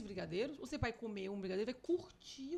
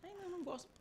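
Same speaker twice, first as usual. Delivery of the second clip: whiny refusal; 9 semitones higher, 5 dB quieter, faster than usual. Retold or imitated. Friend refusing food.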